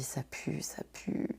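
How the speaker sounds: hushed, breathless whisper of dread; voiceless inner alarm; whispered thought. Retold or imitated. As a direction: whisper it to yourself in panic. Inner panic thought at customs.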